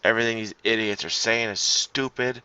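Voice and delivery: ordinary talk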